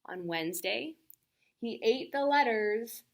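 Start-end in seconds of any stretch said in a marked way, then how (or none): none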